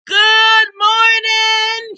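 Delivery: high, slow sing-song call out the window; sing-song hen calling good morning. Acted. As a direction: sing it out to the neighbours. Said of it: "she called".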